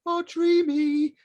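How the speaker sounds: hammed-up starstruck delight; mock swooning. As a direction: swoon it out, pitched right up. Acts own delight at being shared.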